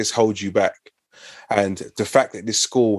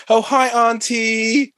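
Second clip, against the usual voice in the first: much higher, slow, sugary polite greeting; proper phone voice; 13 semitones higher, slower than usual. Retold or imitated. Kid greeting auntie on the phone, high proper voice. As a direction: sweeten up for the auntie on the line. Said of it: "you better speak properly".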